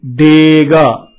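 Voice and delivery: teacher drawing out డేగా slowly; slow model reading. Shown as a demonstration. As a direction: stretch each syllable for the class to copy. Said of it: "ఈ రెండక్షరాల మాట".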